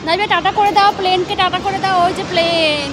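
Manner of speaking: loud, high, stretched bye bye to the sky; excited calling out. Real, not acted. Mother urges Nabiha to wave at the plane, calling out loudly. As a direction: call out to the plane as if it could hear.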